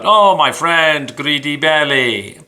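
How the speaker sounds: slow, half-hearted imitation of a Ukrainian accent; a hint of a Ukrainian accent, done badly; terrible Ukrainian accent. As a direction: lightly put on Ukrainian accent, slowed down. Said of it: "it's a terrible accent".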